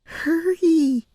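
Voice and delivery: put-on urging girl. A narrator's reading. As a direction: push her to hurry. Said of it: "urged Penelope".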